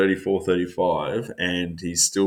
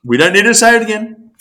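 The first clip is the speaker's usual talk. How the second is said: higher and louder, laughing shut-down; half-laughing protest; 14 semitones higher, 9 dB louder than usual. Real, not acted. Co-host cuts off repeated dig, higher and louder. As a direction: cut him off before he repeats it.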